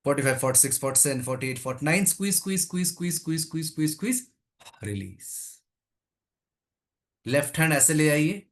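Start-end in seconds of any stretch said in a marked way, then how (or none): 2.1-4.2 rapid-fire run of coaching cues; urging repetition. Real, not acted. Rapid final squeezes at count's end. 4.8-5.6 low, hushed release cue; soft release. Real, not acted. Coach releasing after squeezes.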